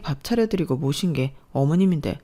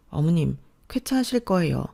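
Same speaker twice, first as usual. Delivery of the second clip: usual speaking voice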